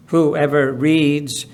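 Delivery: ordinary talk, nothing marked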